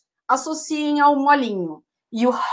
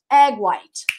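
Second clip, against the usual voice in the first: slow model; much slower than usual. Shown as a demonstration.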